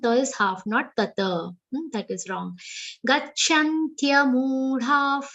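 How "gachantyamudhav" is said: Gachantyamudhav chanted slowly with a clear tya; correct Sanskrit sounding. Shown as a demonstration. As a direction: sing out Gachantyamudhav, tya joined, no dropped ya. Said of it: "Tyamudhav and not gachantamudha".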